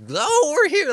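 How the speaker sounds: very high hammed-up sidekick voice; over the top. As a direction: ham it up, squeaky high. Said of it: "they are doing over the top".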